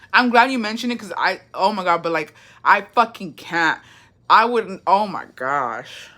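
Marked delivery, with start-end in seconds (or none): none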